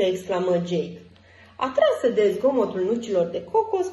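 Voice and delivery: usual speaking voice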